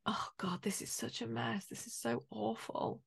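hushed, dismayed whisper of a let-down partner; disappointed whisper. Retold or imitated. Girlfriend whispering her dismay at his secret. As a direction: breathe it out, crushed and quiet. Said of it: "She could be disappointed".

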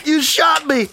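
high, pained outraged cry; shocked angry yell. Acted. Virgil yelling at James after being shot, angry. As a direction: yell at the fool who shot you.

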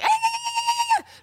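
high shouted battle cry like Xena; mock Xena war cry, way up high; warrior cry. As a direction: yell it like a warrior princess. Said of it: "Sounded like motherfucking Xena".

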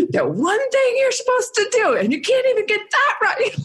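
very high, mock-hysterical rant; comic screechy tirade; retold flip-out. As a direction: re-enact losing it at a husband. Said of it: "I was the crazy, the crazy bitch".

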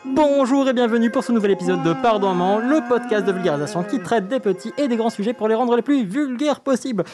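high, loud host welcome; bright podcast intro. Acted. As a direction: open the show with energy.

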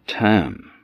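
ordinary delivery, nothing marked

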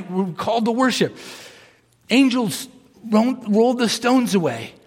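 ordinary talk, nothing marked

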